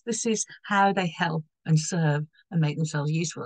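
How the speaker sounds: normal talking voice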